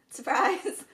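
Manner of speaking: loud playful surprise; spring it on them; excited reveal. Pretend. Jokey reveal after cut.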